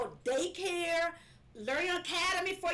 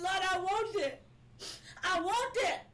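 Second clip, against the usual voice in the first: higher-pitched, insistent plea; retold crying out; 4 semitones higher than usual. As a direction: re-enact a cry to God. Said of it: "I cry so to God".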